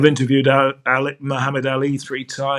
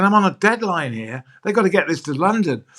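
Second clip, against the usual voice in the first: higher, mock-worried inner voice; anxious inner thought; 6 semitones higher than usual. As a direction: fret to yourself about the deadline. Narrator's anxious thought about deadline.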